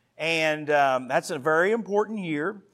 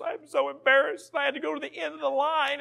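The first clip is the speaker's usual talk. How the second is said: higher, fast, mock-upset child voice; upset little girl; 10 semitones higher, faster than usual. Daughter complaining to grandma, imitated in a higher, fast child voice. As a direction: play his daughter whining about her shame. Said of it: "she told my mom".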